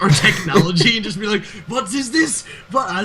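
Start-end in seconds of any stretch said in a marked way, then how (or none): 1.7-2.9 high, soft mock bewilderment; baffled shock. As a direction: play someone baffled by modern technology. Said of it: "would be the most shocked by".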